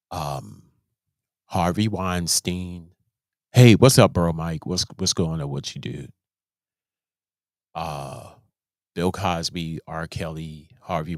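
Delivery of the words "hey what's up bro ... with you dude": loud, breezy hello to a buddy; imitating a loud casual hey; mock casual greeting. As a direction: call out like greeting a friend.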